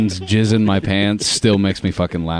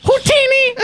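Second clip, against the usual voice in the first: high shouted name. Pretend. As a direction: yell it out high. Shouted joke punchline.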